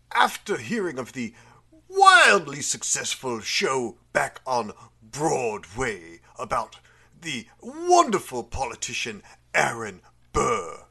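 ordinary talk

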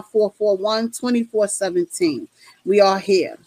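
upbeat call-in show host reading the number; radio host announcement. Acted. Hosts inviting callers to the Ladies Night Show, radio announcer voice.

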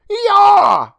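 high loud frightened scream; startled scream. Acted. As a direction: scream at the intruder. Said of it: "Emma screamed".

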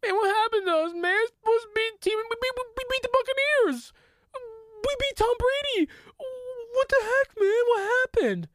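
high, whining fake-crying complaint; mock whiny Cowboys player. Cowboys player whining to his teammate, mock crying. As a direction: wail it like a sore loser. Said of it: "Pintel saying".

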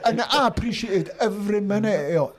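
laughing through high, gleeful words. Real, not acted. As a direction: let real laughter spill into it. Laughing while recalling.

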